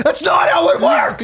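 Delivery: high, drawn-out mock yell; retold yelling. Guest imitates hosts scolding him over the game rules, mock yelling. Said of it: "you just kept yelling at me".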